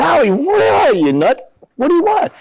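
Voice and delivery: very high-pitched retold outburst; excited banter. Player recognizes old friend on the phone, excited banter. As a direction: act out joshing an old pal.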